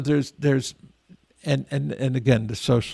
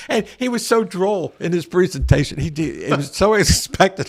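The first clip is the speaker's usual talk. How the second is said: amused, higher-pitched talk through laughter; real laughter breaking into the words; laughing; 7 semitones higher than usual. Real, not acted. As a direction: chuckle while recalling a great punchline. Laughing as he recalls the joke.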